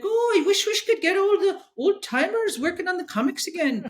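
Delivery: high-pitched mock Scottish voice; Scottish accent. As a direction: imitate a Scotsman, pitched well above your own voice. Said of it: "I'm gonna do the Scottish accent".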